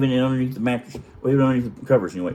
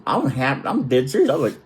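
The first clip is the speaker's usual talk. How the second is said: ordinary talk, nothing marked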